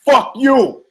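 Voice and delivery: high, loud yelled retort; hollered insult; angry shout back. Retold or imitated. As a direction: snap it right back at him. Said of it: "And I was like".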